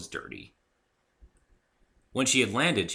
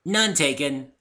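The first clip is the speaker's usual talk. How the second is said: high, loud and bright; cheerily; 7 semitones higher, 7 dB louder, slower than usual. Acted. Pinkie cheerily shrugs off the joke. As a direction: brush it off cheerfully. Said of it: "said Pinky cheerily".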